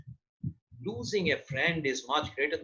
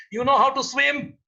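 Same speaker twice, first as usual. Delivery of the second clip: loud challenge; 6 semitones higher, 5 dB louder than usual. Acted. Boatman turns the tables in storm.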